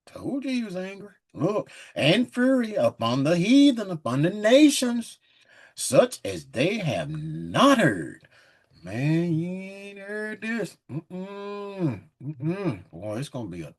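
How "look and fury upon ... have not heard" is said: slow, weighty King James reading; measured, booming Bible reading; solemn scripture reading. Acted. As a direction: read it like a preacher at the pulpit. Reads scripture aloud.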